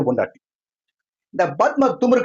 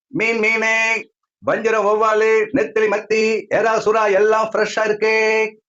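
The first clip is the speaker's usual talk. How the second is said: high, level hawker's chant; fish vendor's cry; 4 semitones higher, slower than usual. Acted. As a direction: call out the catch across the market. Fish seller calls out her wares.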